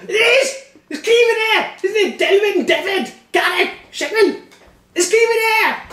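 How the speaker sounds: very high, loud excited squeal; hammed-up excitement. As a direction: shriek out the news to the whole table. [name] calling teammates excitedly.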